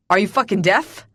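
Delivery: impatient bark rising at the end; barked question. A narrator's reading. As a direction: snap it out, loud and rude. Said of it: "He barks".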